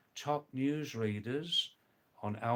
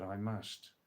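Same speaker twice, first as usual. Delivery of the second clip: usual speaking voice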